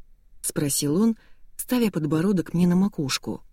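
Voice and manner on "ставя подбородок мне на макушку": usual speaking voice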